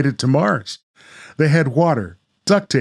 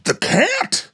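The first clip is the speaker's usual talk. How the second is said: put-on much higher, incredulous kid voice; doubtful young Tom; 13 semitones higher, much slower than usual. Tom questions Grandma's plan, high and doubtful. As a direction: scoff like a disbelieving boy.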